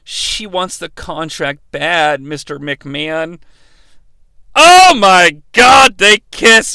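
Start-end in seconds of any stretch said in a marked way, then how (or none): 4.6-6.7 hammed-up shocked yelling; appalled shout. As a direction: play up the horrified outburst. Recapper reacts to on-screen kiss.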